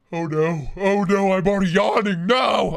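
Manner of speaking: mock dismay shout. Mock dismay at product news.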